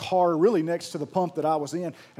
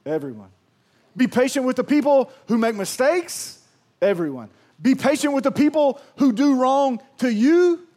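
higher, louder preaching call; full-force preaching; 6 semitones higher, 7 dB louder than usual. Real, not acted. Pastor pressing the church to be patient with everyone, full preach. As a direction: drive it home to the crowd.

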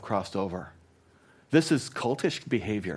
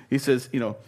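ordinary talk

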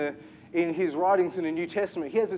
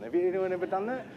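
usual speaking voice

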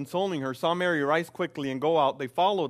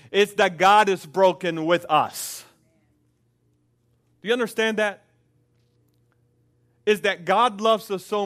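louder preaching climax, pressing each line; impassioned preaching; 3 semitones higher, 5 dB louder than usual. Acted. Pastor urging the church about God's brokenness, impassioned preaching. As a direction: drive it home to the congregation.

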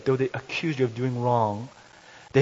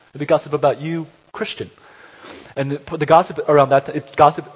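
loud insistent preaching; preacher building to loud; preaching at full force; 4 semitones higher, 11 dB louder than usual. Acted. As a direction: drive the point home hard.

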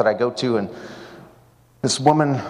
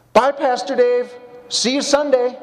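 much higher, louder, slow sing-song farewell from the woman; cheerful goodbye; 11 semitones higher, 3 dB louder, slower than usual. Retold or imitated. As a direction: call it out high and drawn out across the room. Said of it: "I hear this voice".

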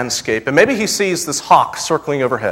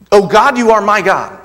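raised, louder cry to God; fervent quote; 6 semitones higher, 6 dB louder than usual. Acted. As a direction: lift the verse up as a plea. Preacher quotes the verse.

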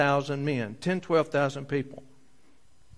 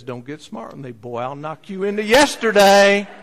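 mock threat; 5 semitones higher, 9 dB louder than usual. Mother threatening boy.

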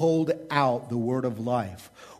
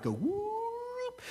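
excited whoop; 9 dB quieter than usual. Acted. Preacher's excited cheer.

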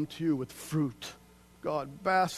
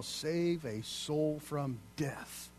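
slow solemn; 5 dB quieter, much slower than usual. Acted. Slowed repeat of the verse.

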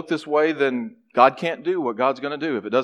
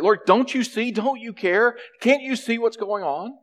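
much higher, exasperated pleading; irritated pleading; 10 semitones higher than usual. Acted. Worshipper vents irritation at Jesus, pleading. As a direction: throw up your hands at the Lord. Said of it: "She's irritated at Mary".

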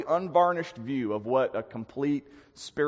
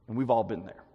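ordinary talk, nothing marked

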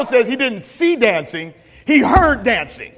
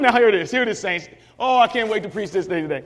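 ordinary talk

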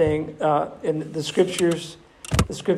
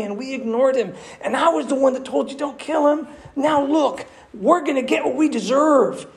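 high, loud, agitated reproach; accusing brother; 9 semitones higher, 3 dB louder than usual. Acted. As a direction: blame your brothers in a rising panic. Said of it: "imagine hearing your brother say".

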